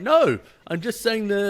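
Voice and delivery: high, drawn-out defensive "no"; protesting. Retold or imitated. Man defending his greeting to offended friends, protesting. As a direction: protest slowly, pitched well up.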